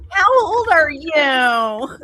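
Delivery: high, slow, laughing whine; whiny voice. Retold or imitated. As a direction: drag it out in a snotty whine. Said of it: "she's in a full whine".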